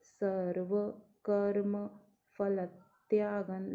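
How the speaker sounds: "सर्वकर्मफलत्यागन्" very slowly, every syllable separated; very slow clear recitation. Shown as a demonstration. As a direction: drag out "सर्वकर्मफलत्यागन्" letter by letter. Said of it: "हर वर्ण को clear बोलना है".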